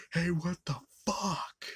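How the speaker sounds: high, drawn-out indignant quote; mock pissed off. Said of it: "Pinker gets all pissed".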